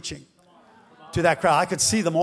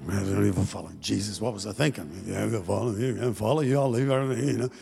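much lower grumbling fisherman, complaining; grumbling Peter; 9 semitones lower, 5 dB quieter, faster than usual. Retold or imitated. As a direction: sulk low as a proud fisherman. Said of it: "he's rolling out".

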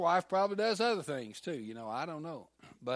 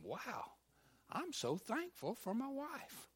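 grateful wonder; 8 semitones higher, 8 dB quieter, slower than usual. Retold or imitated. Pastor retells a grateful thought.

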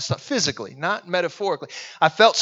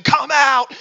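loud, higher-pitched mock command; mock exorcist yell; 7 semitones higher, 5 dB louder than usual. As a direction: yell it like an over-the-top exorcist. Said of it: "started screaming and yelling".

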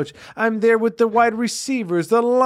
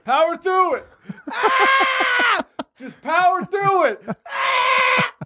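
high agonized cries between words; pained yelling; 13 semitones higher, much slower than usual. Pretend. Quarterback with a snapped ankle urging himself on, screaming high. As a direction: scream through the pain, forcing yourself on.

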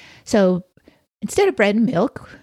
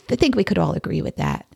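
usual speaking voice

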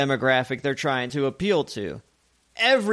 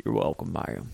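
put-on deep, soft country boy with a wow in the vowels; low, quiet twangy courtesy; low country twang; 8 semitones lower, 6 dB quieter than usual. Shown as a demonstration. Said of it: "Have a little wow in that".

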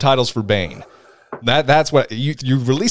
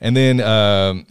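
ordinary talk, nothing marked

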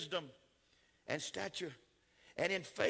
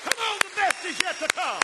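loud preaching; 12 semitones higher, 9 dB louder than usual. Acted. Repeated climax line, higher.

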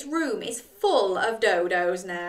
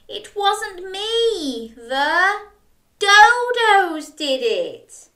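high, very slow whiny protest growing louder; Jack wailing; 9 semitones higher, 5 dB louder, slower than usual. Acted. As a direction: wail it like a blamed child. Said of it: "Things were bad".

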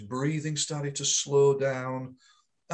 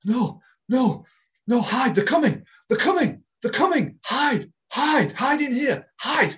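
high, loud frantic fear; terrified, urging others to hide; panicked terrified voice; 10 semitones higher, 7 dB louder than usual. Retold or imitated. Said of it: "he started to say".

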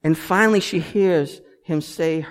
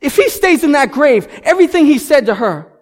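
loud, high, driving sermon voice; full-force preaching; 8 semitones higher, 9 dB louder than usual. Acted. Pastor stirring the congregation: fiery preaching. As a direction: preach it out hard to the crowd.

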